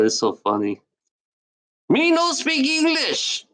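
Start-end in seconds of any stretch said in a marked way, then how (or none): 1.9-3.5 very high, slow falsetto taunt; high falsetto mockery; squeaky mock broken English. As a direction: squeak it out like a cartoon foreigner.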